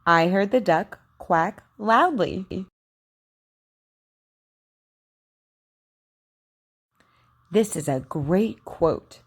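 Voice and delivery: slow, carefully shaped practice sentences; over enunciating. Shown as a demonstration. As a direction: model each Q word slowly and crisply. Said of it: "Just repeat after me".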